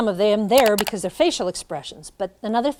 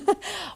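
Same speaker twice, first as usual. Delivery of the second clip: short laugh; 12 semitones higher, 4 dB quieter than usual. Real, not acted. Laughs at own slip.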